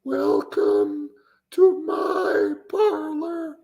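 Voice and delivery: slow, quavering high impression; Paul Bear. Streamer retries the manager impression, high eerie drawl. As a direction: stretch it out, straining up into an eerie falsetto. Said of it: "My voice doesn't go high enough".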